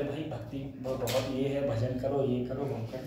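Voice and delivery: low, soft mock-pious advice; devout friend imitated. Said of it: "बोले". (भक्त दोस्त की धीमी, दबी आवाज़ में नकल)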